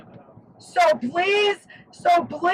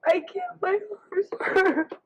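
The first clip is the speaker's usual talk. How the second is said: ordinary talk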